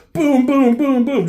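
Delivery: loud, high imitated blasts; gunshot sounds. Retelling the medic's shotgun fight, loud imitated blasts. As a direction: fire off each boom like a shotgun.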